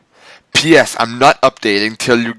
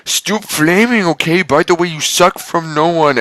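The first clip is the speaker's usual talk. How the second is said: high mocking author voice; mock angsty author voice; 7 semitones higher than usual. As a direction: parody the whiny author. Author's note read mockingly.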